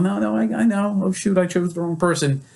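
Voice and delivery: mock the patient who slipped up; flustered patient. Group member correcting herself, flustered.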